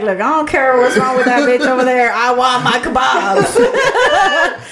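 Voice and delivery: hammed-up angry friend, dragging out the last words; mock-mad demand breaking into laughter. Volunteer imitates the customer's friend wanting kebabs, mock mad. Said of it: "he was so mad".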